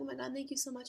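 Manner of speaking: high-pitched retold gushing; mildly breathless-with-joy imitation of yourself; excited thanks. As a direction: replay a thrilled thank-you. Retold thrilled thanks on phone.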